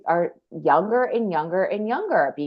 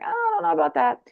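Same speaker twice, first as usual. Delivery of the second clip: retold hesitation, pitched way up; doubtful balking; 12 semitones higher than usual. Woman balking at boyfriend's name idea, high and doubtful.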